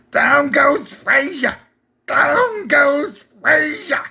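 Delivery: high, slow announcer cry, stretched; weak Cosell impression, hammed up; Howard Cosell impression. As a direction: call it like a ringside announcer. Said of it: "Pretty weak, Howard Cosell".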